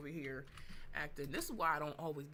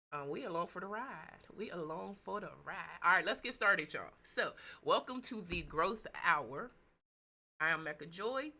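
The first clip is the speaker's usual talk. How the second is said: ordinary talk, nothing marked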